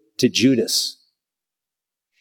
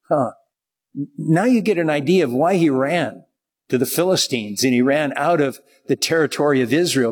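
ordinary talk

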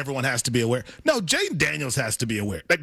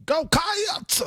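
"Cats" much higher, stretched into two syllables; preacher-style "Cats"; 14 semitones higher than usual. Acted. Co-host attempting the cheer, preacher-like. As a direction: wail "Cats" like a Sunday sermon.

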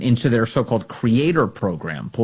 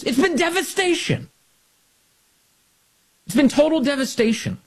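emphatic outrage; 14 semitones higher than usual. Acted. Emphatic political rant line.

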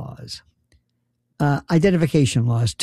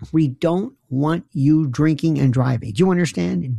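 mock stern lecture. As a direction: lay down the law word by word. Mock official lecture to audience.

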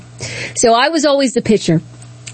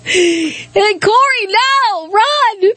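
higher, drawn-out frantic call to her brother; panicked call; 9 semitones higher, much slower than usual. Retold or imitated. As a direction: call out like the birds are right behind him. Reliving warning brother about birds.

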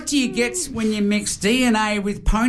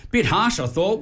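usual speaking voice

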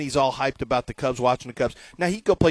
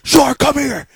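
high, loud summons; dad calling his wife in again; 11 semitones higher, 8 dB louder, slower than usual. Retold or imitated. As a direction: holler her into the room.

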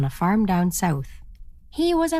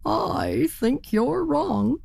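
high, very slow stretched words; doubting turkey; 7 semitones higher, much slower than usual. A narrator's reading. Turkey contradicts the ferry captain, high and slow. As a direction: draw it out, pitched up. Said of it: "said the turkey".

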